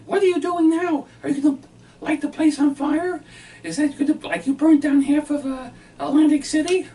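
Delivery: mimicking his wife. Husband mimics his wife scolding him at dinner, high and loud. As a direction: squeal it out like a shocked wife. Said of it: "she said".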